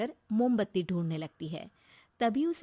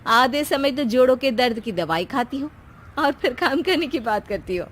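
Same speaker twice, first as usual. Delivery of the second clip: mock agreement; 4 semitones higher, 7 dB louder than usual. Daughter-in-law teasing mother-in-law.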